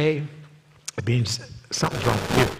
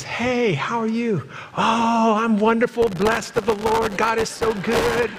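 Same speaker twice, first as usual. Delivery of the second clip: high, sugary put-on church greeting; fake cheerful churchgoer; 8 semitones higher, slower than usual. Mocking a couple who just quarreled, all fake smiles. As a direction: gush like everything is perfect.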